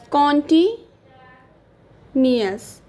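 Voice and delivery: drawn out very slowly, syllable by syllable; slow dictation. Shown as a demonstration. Teacher to class, spelling out the word slowly. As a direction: stretch it out so students can write it.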